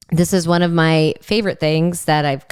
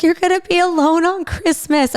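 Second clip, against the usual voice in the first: high, tearful mock wail of pity; imitating crying friend; 10 semitones higher than usual. Best friend pities her lonely Christmas: high mock-tearful wail. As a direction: play the best friend welling up, a touch hammed. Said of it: "And she started crying".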